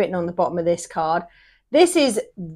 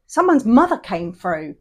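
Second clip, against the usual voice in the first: ordinary talk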